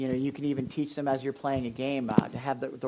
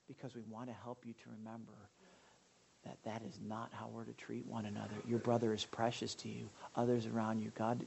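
much softer, gentle explanation; tender father; 6 semitones lower, 12 dB quieter than usual. Retold or imitated. Father gently explaining to his son after discipline, tender. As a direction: speak it softly to a hurting child.

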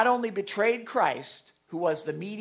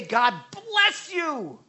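"God bless you" slow and higher; emphasis on blessing; 8 semitones higher, much slower than usual. Shown as a demonstration. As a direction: lean hard on "bless". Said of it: "Emphasis on the blessing".

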